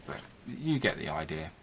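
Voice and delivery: ordinary delivery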